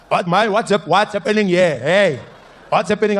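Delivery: put-on loud drunk uncle shouting his greeting. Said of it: "There he was".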